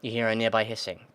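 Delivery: ordinary talk, nothing marked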